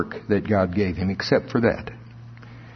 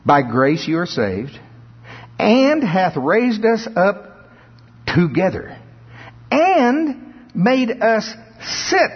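rising, drawn-out pulpit reading of scripture, much higher and slow on the climax; preacher lifting the verse higher and slower; preaching scripture reading; 6 semitones higher than usual. Acted. Preacher reading Ephesians to the congregation in slow, soaring cadence.

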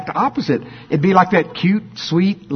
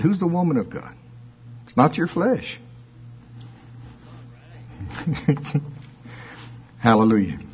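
ordinary talk, nothing marked

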